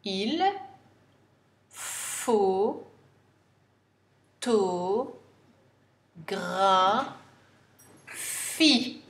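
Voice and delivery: photographie dragged out extremely slowly; very slow dictation. Shown as a demonstration. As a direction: spell out the sounds of photographie for dictation. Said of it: "N'oubliez pas le E muet".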